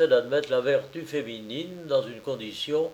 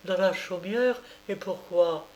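near tears; 8 semitones higher than usual. A narrator's reading. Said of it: "et elle commença à pleurer".

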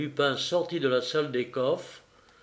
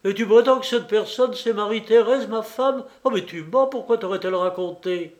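outraged disbelief; 7 semitones higher, 5 dB louder than usual. A narrator's reading. Banker outraged about his wife.

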